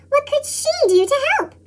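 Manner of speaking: usual speaking voice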